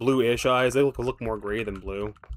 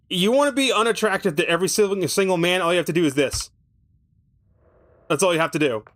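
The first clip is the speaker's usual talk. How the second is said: a touch higher and quicker, teasing advice; mock sales pitch; 6 semitones higher, 4 dB louder, faster than usual. Gamer teasing viewers about nose rings, mock-cheery pitch. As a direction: sell the ugly nose ring with mock cheer.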